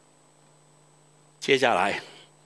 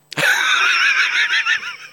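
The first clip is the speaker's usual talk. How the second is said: loud laugh; 4 dB louder than usual. Real, not acted. Preacher laughs at own joke.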